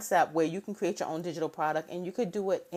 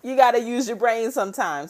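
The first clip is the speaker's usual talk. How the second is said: emphatic retort; 5 semitones higher, 7 dB louder than usual. Retold or imitated. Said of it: "And I'm like".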